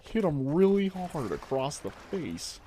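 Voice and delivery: mock Patrick Warburton giving a talk, slightly wavering; half-kept Patrick Warburton impression. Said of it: "from Patrick Warburton".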